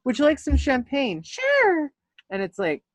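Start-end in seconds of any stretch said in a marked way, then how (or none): 0.0-1.2 shop assistant. Retold or imitated. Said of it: "This is how people". 1.2-1.9 eager squeaky yes; high squeaky sure. Retold or imitated.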